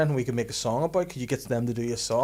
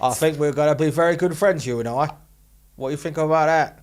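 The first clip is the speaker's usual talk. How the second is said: Cockney actor impression, pushed louder; Michael Caine; 3 semitones higher, 5 dB louder than usual. Co-host finishing the AI's greeting, Cockney impression. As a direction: parrot the greeting as a Cockney star. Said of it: "Give him, give Michael Caine on".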